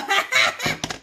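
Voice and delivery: high excited laugh; hyped laugh. Real, not acted. Cooking salsa, laughing high with hype. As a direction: laugh out, thrilled. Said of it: "Sorry, I'm hyped".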